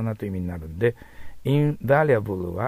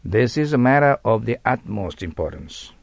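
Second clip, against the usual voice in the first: model sentence; 5 dB louder, slower than usual. Shown as a demonstration. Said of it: "となります".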